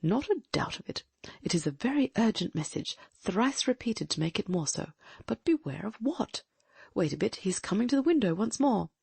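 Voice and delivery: alert, intent gentleman detective; keen detective voice. Acted. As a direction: voice a sharp, watchful sleuth. Holmes voiced in a reading.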